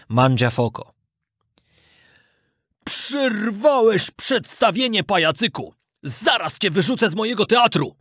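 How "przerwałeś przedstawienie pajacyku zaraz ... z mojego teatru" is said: mild mock shout of menace; menacing cry. A narrator's reading. Theatre owner scolding puppet, menacing raised voice. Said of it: "krzyknął groźnie".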